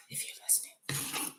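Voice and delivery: breathy aside to the men; teasing whisper. Acted. Whispering to the gentlemen in the chat, a teasing hush. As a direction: hush it like a secret.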